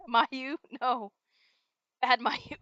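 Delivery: loud, laughing scold like to a puppy; mock scolding. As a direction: scold her like a naughty dog.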